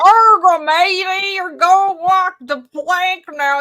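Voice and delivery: high, loud hammed-up pirate growl; pirate talk. Guest hams up a pirate for the chat, high and loud. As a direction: play the salty pirate for the chat. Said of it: "[name] gives the chat your best pirate talk".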